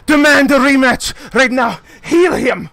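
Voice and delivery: high, loud furious demand; losing his temper. Acted. Enraged fighter demands a rematch, furious shouting. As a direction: bellow it in a rage. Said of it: "absolutely just losing his shit".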